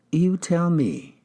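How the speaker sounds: slow, high and pointed. Acted. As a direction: drag it out, pressing him. Detective pressing the suspect.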